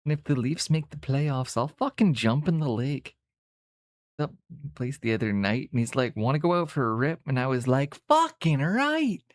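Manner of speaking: hammed-up Canadian hoser accent. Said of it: "we can all turn the accent on".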